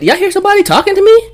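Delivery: loud, very high feigned confusion; mock-oblivious snub. Man snubs her before her friends, mock oblivious. Retells loudly snubbing the woman.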